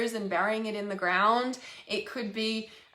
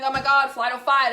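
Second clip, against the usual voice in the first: loud, higher frantic outburst; stressed panic; 3 semitones higher, 8 dB louder than usual. Retold or imitated. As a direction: blurt it out like a frazzled mum in fight-or-flight. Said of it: "when we're stressed out and we're like".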